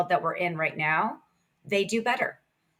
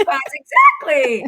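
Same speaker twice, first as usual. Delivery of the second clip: amused; 6 semitones higher, 9 dB louder, slower than usual. Real, not acted. Laughing agreement, loud.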